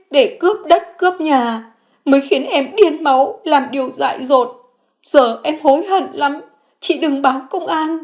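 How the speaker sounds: high, pleading woman's voice; Phượng begging her sister-in-law, high and pleading; remorseful sister-in-law. A narrator's reading.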